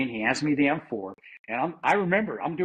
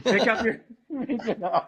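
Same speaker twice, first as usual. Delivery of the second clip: laughing; 9 semitones higher, slower than usual. Real, not acted.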